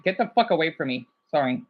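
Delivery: normal talking voice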